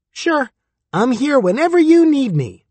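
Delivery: high squeak, a tiny talking dick; squeaky voice. A narrator's reading. Durden voicing his dick to the audience, high squeak. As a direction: squeak it up high like a cartoon critter.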